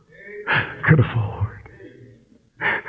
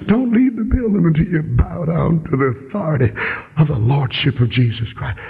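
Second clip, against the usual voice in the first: ordinary talk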